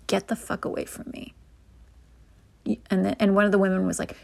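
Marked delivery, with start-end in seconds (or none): 0.1-1.3 whispered retort. Retold or imitated. Repeat of the women's retort.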